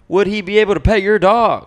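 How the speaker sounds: high, loud radio-host voice; a loud, high voiceover parody; conversational voiceover read. Acted. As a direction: read it like a cheesy announcer. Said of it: "How was that voice?".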